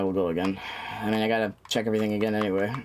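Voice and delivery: ordinary talk, nothing marked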